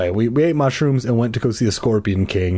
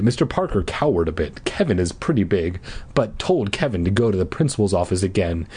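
ordinary delivery, nothing marked

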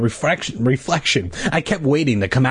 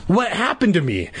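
plain reading voice

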